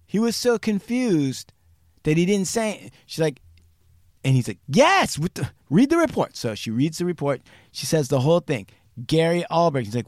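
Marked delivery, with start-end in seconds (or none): 4.7-6.3 high, loud, hurried retort; impatient outburst. Retold or imitated. Confused agent telling her to read the report, loud impatient outburst. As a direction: burst out with an exasperated yes.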